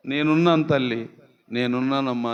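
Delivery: tender reassurance. Acted. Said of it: "Prabhu పలకరించాడండి".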